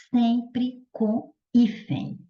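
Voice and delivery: each word drawn out and set apart; slow emphatic rule. Shown as a demonstration. Teacher giving students the conclusion, slow and emphatic. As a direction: spell out the rule slowly, word by word.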